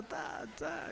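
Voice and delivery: resigned little whisper. Retold or imitated. Losing fans sighing to each other, whispered. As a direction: breathe it out, deflated.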